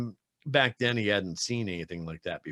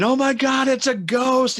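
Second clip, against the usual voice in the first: much higher and louder frightened shriek; imitating a scared child's scream; 10 dB louder, slower than usual. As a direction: shriek like a kid who saw a ghost. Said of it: "just starts screaming".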